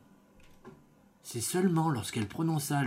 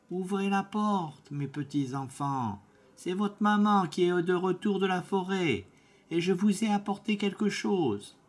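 wolf faking mother; 7 semitones higher than usual. Acted. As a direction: lure the kids in gently, lightly voiced. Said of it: "il frappa et cria".

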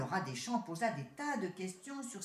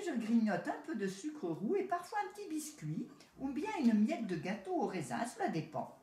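higher, showy city rat listing his meals; boastful city rat; 3 semitones higher than usual. Acted. City rat bragging to his country cousin, a little higher. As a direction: show off each treat, lightly voiced.

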